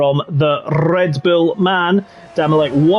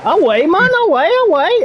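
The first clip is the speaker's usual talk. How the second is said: hammed-up Geordie calling out; mock Geordie accent; 12 semitones higher than usual. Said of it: "waiting for the Geordie to come out next".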